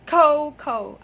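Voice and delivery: slow, loud KOH-KOH for cocoa; correct pronunciation. Shown as a demonstration. Teacher asking learners to say it so, correct pronunciation. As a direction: stretch both long O sounds in cocoa. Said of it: "please say cocoa".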